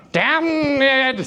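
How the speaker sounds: Damned! said as dam-ned, two beats; two-syllable damned. Shown as a demonstration. Teacher demonstrating Damned! as two syllables. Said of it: "damned is now damned".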